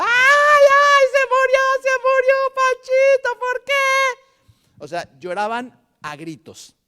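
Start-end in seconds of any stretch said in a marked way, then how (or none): 0.0-4.8 shrieking lament, very high and loud; mock wailing shout. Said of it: "Había gente que estaba gritando". (grito de dolor muy agudo y fuerte)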